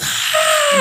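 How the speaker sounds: high cheering ah; crowd cheer. Retold or imitated. Crowd at the [name] concert, mimicked high cheer. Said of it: "it's a crowd of people just".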